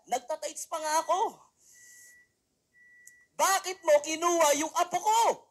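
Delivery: put-on high, angry old woman demanding answers; angry grandmother. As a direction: scold God for taking the grandchild. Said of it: "Galit na galit na ganun".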